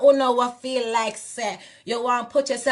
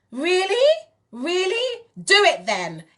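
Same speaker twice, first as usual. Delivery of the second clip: sarcastic challenge; 7 semitones higher, slower than usual. Real, not acted.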